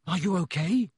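urgent, rising question. A narrator's reading. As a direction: ask with pressing concern. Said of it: "Harry said urgently".

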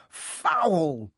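crowd's drawn-out cry, falling steeply; a mild scream of protest from the stands. A narrator's reading. Gryffindors protesting a foul, restrained scream. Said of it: "screamed the Gryffindors".

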